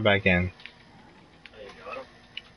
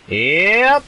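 loud resigned yep; 9 semitones higher, 6 dB louder than usual. Real, not acted. Rocket hits as predicted.

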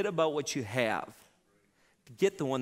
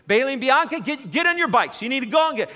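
loud, higher commanding voice; bossy orders; 8 semitones higher, 8 dB louder, faster than usual. Retold or imitated. Worried mother ordering daughters to search, loud. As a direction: order the girls onto their bikes. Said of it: "but she".

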